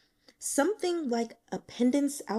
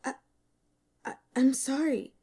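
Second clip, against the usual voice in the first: put-on halting, higher girl's apology; faltering; 6 semitones higher than usual. A narrator's reading. Student stammering to the stern teacher, faltering. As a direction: stammer out a frightened sorry.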